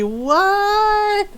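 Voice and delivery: high, drawn-out questioning squeak; playful protest. Pretend. Player admitting that's literally her, playful protest. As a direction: pitch it way up in mock disbelief. Mock innocent self-remark.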